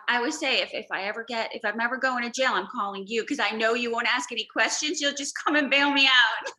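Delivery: excited joking. Real, not acted. Joking with friend about jail.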